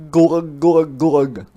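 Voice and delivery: loud gurgly glug glug glug; waterlogged hedgehog gurgle; gurgling. Acted. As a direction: gargle it like water in the throat. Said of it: "gurgled Hedgehog".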